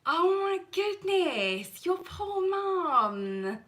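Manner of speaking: high, slow, drawn-out outrage; mock scandalised. Real, not acted. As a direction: scold a friend in playful shock. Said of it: "Oh, I feel bad".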